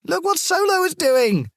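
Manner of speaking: put-on very high squealing girl voices; little girls shrieking. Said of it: "The three girls shrieked".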